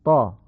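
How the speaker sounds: 'طا' with a dark, back, short 'aw'; loud, full-mouthed 'to' for 'طا'; heavy Arabic ta. Shown as a demonstration. Teacher showing the letter, heavy 'طا'.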